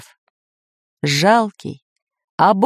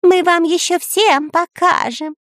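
very high parrot voice; screeching parrot; 12 semitones higher than usual. A narrator's reading. Parrot yells threats from below, high squawk. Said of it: "кричал Кутя-Плютя".

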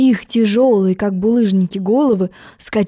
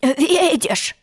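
short higher-pitched hoarse croak; croaking frog; 5 semitones higher than usual. Acted. Frog showing Alice the kicked door, hoarse croak. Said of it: "сказал он".

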